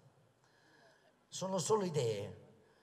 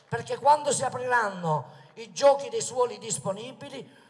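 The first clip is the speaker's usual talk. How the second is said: loud rally oratory, projected to the crowd; booming balcony speech; 6 semitones higher, 7 dB louder than usual. Acted. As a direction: proclaim it from the balcony.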